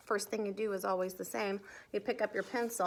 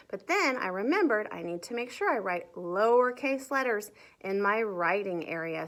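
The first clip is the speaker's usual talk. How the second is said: ordinary delivery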